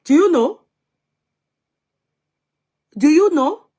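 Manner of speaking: loud, high-pitched questioning; emphatic challenge. Real, not acted. Host challenges viewers about the upkeep, loud and emphatic. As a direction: throw the question out loudly, high.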